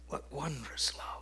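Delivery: whispered, soft and low; hushed awe at God's love; awed whisper. Real, not acted. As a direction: breathe it out in wonder.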